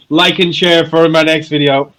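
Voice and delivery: loud hammed-up influencer plea; youtuber voice. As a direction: beg for likes like a YouTuber. Mocking youtuber sign-off.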